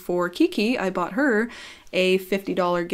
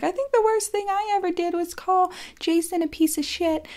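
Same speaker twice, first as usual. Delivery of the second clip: much higher, dainty voice for the friend's confession; innocent girl voice; 11 semitones higher than usual. Retold or imitated. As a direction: play her as sweet and naive, voice pitched way up. Imitating Kiki's innocent confession.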